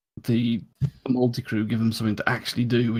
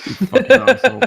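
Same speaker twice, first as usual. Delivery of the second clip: high, loud playful jab; mock insult; 13 semitones higher, 7 dB louder than usual. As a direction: bark it out jokingly. Mock insult among friends.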